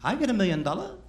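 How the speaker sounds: high, loud mock character voice. Comic voicing a character's lines.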